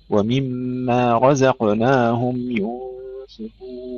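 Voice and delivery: slow chant, رزقناهم with a light, clear ز; light zay. Shown as a demonstration. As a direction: chant it slowly, keeping the ز of رزقناهم thin after a heavy ر. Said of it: "رقق الزاي جيدا". (ترتيل بطيء بترقيق الزاي في «رزقناهم»)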